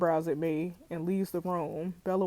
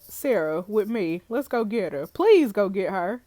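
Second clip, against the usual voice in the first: ordinary talk, nothing marked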